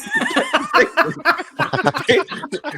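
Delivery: high giggling fit; long high laughing fit. Real, not acted. Guest laughing at his own face-mask punchline. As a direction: lose it completely.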